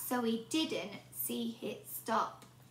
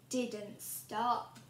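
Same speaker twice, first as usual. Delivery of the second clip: usual speaking voice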